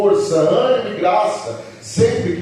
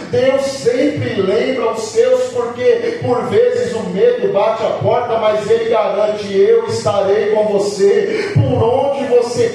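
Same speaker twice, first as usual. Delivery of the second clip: loud, raised preaching voice with stretched key words; forceful preaching; 4 semitones higher, 7 dB louder than usual. Real, not acted. Preacher tells the congregation God will be with Joshua, forceful preaching. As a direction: preach it at full force, loudly driving each phrase.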